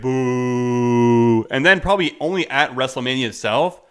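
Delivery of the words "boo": long, level drawn-out boo; mock crowd boo. Imagined crowd rejects the match announcement, mock booing. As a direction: hold one long boo that swells at the end. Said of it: "everyone's gonna be like".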